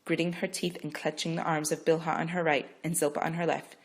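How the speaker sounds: usual speaking voice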